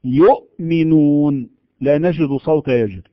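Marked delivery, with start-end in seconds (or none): 0.0-1.5 يؤمنون slow, each sound drawn out, hamza closed tight; stopped hamza. Shown as a demonstration. Teacher showing students the shidda in يؤمنون, slowly. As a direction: stop the air dead on the hamza in يؤمنون. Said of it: "نقول".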